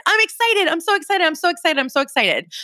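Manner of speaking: high excited burst easing down; eager repeated affirmation, high at the start; excited self-talk. Acted. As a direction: hype yourself up, starting high and settling. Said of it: "That's the first thing you can say".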